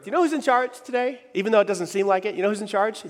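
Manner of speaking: normal talking voice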